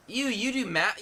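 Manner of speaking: high, loud mock-disgusted "ew". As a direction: recoil at the question. Reads a disgusted viewer question.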